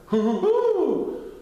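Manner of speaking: slow, very high loud hoots; loud drawn-out high hoo hoo; hooting laugh. Acted. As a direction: hoot like an owl with glee. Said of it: "hooted Titter".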